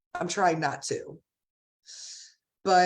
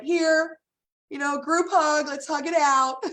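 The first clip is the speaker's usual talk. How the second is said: higher-pitched upbeat imitation of a stylist; retold rallying call; 7 semitones higher, 3 dB louder than usual. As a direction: imitate someone rounding everyone up for a hug. Stylist rallying crying group for hug.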